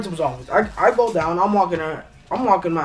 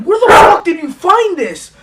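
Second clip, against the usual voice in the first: high, loud furious demand; student confronting the principal over the book; indignant outburst; 11 semitones higher, 8 dB louder than usual. Retold or imitated. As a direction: demand an answer, outraged.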